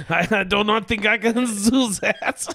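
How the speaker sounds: laughing, badly faked French accent; mock French accent. Comic asked for a French accent, badly faked French. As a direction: ham up a bad French accent through laughter. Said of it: "can you do a French accent".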